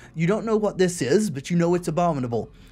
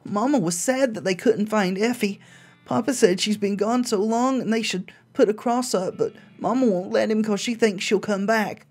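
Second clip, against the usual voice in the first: put-on high, childlike diary reading; little girl voice; 6 semitones higher than usual. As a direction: read it like a scared little girl.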